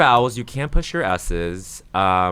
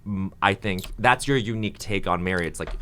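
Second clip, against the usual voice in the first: ordinary delivery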